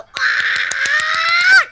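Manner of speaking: very high, loud cartoon scream; shrill scream. Snorkel screaming while charging up, very high. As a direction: shriek in panic. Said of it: "Snorkel was like".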